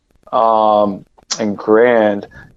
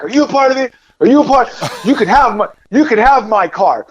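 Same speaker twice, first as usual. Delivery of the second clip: high, frantic pleading yell; panicked shouting at strangers in a lot; 10 semitones higher than usual. Retold or imitated. Panicked man yelling at strangers in a parking lot, high and frantic.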